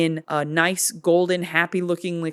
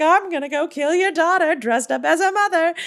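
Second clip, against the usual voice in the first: high, put-on gloating queen voice; gleeful villain queen; 10 semitones higher, 4 dB louder than usual. Queen taunting the king, high gloating villain voice. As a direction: sing out the evil plan, much higher. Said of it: "she's like".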